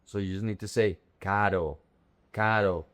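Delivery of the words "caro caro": caro with a soft tapped R. Shown as a demonstration. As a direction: let the r in caro flow in one light flick. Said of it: "The R is a soft R".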